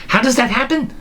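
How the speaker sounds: high-pitched baffled question; acted bafflement; mock puzzlement.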